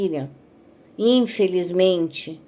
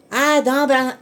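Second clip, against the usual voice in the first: coaxing grandmother voice; 6 semitones higher, 3 dB louder than usual. Retold or imitated. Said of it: "Ela falava".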